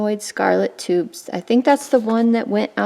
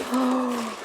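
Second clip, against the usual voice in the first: amazed gasp; 3 semitones higher, 7 dB quieter than usual. Real, not acted. Seeing more gifts in the box, amazed gasp. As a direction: gasp in sheer wonder.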